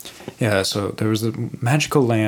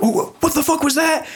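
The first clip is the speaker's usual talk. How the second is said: high, imitated startled question. Buddies come out after hearing a scream, startled. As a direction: pitch it way up in alarm.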